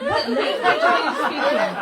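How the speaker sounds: high, loud, laughing question; baffled bystander. Retold or imitated. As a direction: play the stunned stranger, squeaking up high. Bystanders puzzled by chanting.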